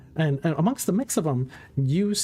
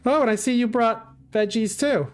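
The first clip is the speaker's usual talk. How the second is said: high, bright rabbit lady greeting; 8 semitones higher than usual. Acted. GM voices Amantha again.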